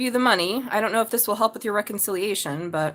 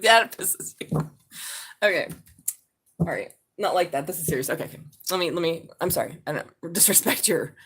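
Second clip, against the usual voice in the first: normal talking voice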